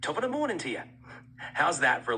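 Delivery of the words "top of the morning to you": lilting, high-pitched Irish greeting; Irish accent. Shown as a demonstration. Voice assistant showing off accents on request, Irish accent. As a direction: say it as a stock Irish hello. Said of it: "And here's an Irish accent".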